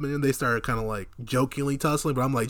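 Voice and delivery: usual speaking voice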